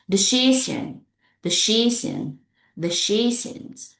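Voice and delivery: DECISION with a long, stressed CI; light de, push CI in DECISION; second-syllable stress. Shown as a demonstration. Teacher models second-syllable words, stress on CI. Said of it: "words with stress on the second syllables".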